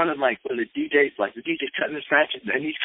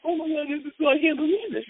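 high-pitched, re-enacted elation; giddy disbelief. Recalling walking on clouds after the set, giddy disbelief. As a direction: gush in a high voice, as if floating.

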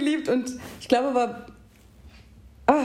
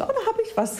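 eager chirp; 12 semitones higher than usual. Retold or imitated. Eager child volunteering an idea.

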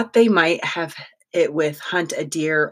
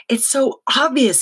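excited; 9 semitones higher, 4 dB louder than usual. A narrator's reading. Max excited realization.

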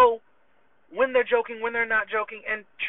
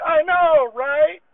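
high and loud, I know right; retold buddy going loud and high in agreement; mock eager agreement; 7 semitones higher, 8 dB louder, much slower than usual. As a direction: pitch it up, all eager agreement.

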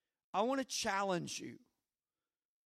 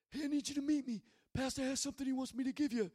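higher, put-on mafia-guy voice; Paul; 5 semitones higher, 3 dB quieter, much faster than usual. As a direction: imitate an Italian New Yorker, pitched up. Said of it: "this is how he talks".

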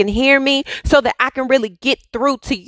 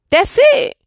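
high exclamation; 12 semitones higher than usual. Retold or imitated. God's exasperated punchline.

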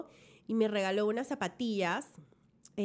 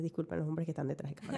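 laughing aside; 7 semitones lower, 7 dB quieter, faster than usual. Real, not acted. Aside apology to crew.

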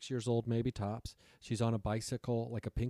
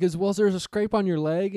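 high, loud anxious voice; desperate for proof; anxious question; 9 semitones higher, 9 dB louder than usual. Retold or imitated. Narrator anxiously asks Megan about a scrape, high and loud.

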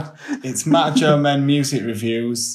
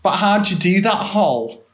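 a higher, lightly voiced Lister; mock Lister, pitched up; Lister impression; 7 semitones higher, much slower than usual. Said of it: "go and do your Lister impression".

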